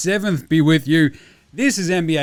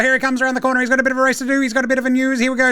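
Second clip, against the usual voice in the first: race caller; 8 semitones higher, much faster than usual. Shown as a demonstration. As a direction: call it like horses rounding the bend. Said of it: "Sounding like a race caller".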